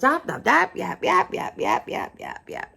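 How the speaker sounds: high chatter fading to a whisper; mock yapping. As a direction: mimic endless yapping, dying away. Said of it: "at the top of her lungs".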